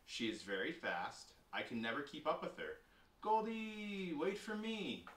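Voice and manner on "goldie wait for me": high, drawn-out call after someone ahead. Acted. Child to pet goldfish racing ahead, calling out. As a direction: call for them to wait, pitched high.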